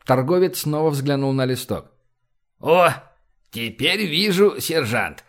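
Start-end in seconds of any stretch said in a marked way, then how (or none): 2.6-5.2 put-on creaky old man exclaiming. A narrator's reading. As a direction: crack out the words like an old trader. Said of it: "воскликнул он скрипучим голосом".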